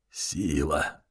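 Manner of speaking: low hoarse wheeze; wheezing rasp. A narrator's reading. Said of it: "прохрипел он".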